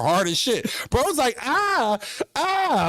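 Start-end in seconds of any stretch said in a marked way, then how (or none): 1.4-2.9 high mock pained ah ah; weird scream. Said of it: "making this weird ass scream".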